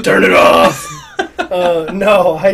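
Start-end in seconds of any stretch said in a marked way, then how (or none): 0.0-0.9 gruff growling superhero shout; Batman voice. Pretend. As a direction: bark it like Batman. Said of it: "You don't turn into Batman".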